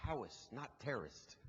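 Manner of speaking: softly whispered correction; retold whisper. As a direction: lean in and hush it like a cameraman's aside. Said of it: "he whispered in my ear".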